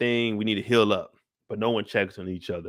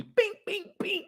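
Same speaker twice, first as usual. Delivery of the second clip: mock hit noises; 6 dB quieter than usual. Imitating zombie hits.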